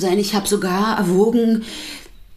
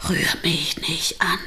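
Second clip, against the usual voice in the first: whispered, softer, imitating a gasped plea; retold gasp; 2 semitones lower, 6 dB quieter than usual. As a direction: quote him as a gasping whisper. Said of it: "hat er gekeucht".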